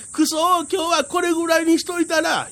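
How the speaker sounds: high, fast, face-saving bluster; sore loser. Acted. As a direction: bluff it out after losing. 負け惜しみを言う. (負け惜しみ)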